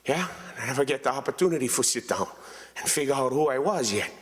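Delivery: normal talking voice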